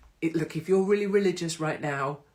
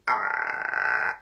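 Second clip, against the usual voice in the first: mock groan; 9 semitones lower than usual. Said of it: "They literally".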